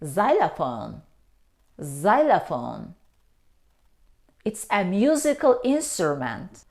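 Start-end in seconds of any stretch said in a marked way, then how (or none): 0.0-2.9 xylophone begun with a plain z, "ZY-luh-fone". Shown as a demonstration. Teacher correcting learners, xylophone said with an initial z. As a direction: say xylophone with z, no k sound. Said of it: "The letter X isn't pronounced".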